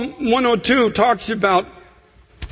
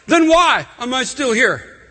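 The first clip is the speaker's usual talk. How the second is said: raised, pleading preacher's prayer, building; impassioned pleading prayer; 4 semitones higher than usual. Acted. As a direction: cry out to God in full preaching force.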